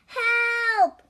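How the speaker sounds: high, loud drawn-out yell; one long, high call for help; crying for help. A narrator's reading. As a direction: cry out in alarm as Toad. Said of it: "cried Toad".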